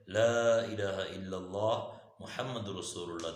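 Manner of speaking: slow recitation with long vowels; drawn-out, measured chant-like delivery; kalima recitation. Real, not acted. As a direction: stretch out the Arabic as you recite. Recites the kalima while teaching.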